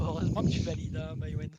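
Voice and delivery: hoarse, worn-out voice after shouting; straining a voice that's almost gone; lost voice. Real, not acted. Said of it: "parce que j'ai plus de voix".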